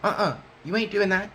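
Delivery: high, sharp mock-stern no way; firm refusal. Blocking the twelve-year-old at the door, high firm refusal. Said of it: "'cause I'm like".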